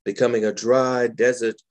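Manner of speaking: calm, measured poetry recital; gentle spoken-word reading; poem reading. Acted. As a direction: savor each image as you read. Reads second poem aloud.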